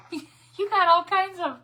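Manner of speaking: high, loud, slowed delight; gushing over all the colours; excited laughing squeal. Real, not acted. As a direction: laugh it out, thrilled.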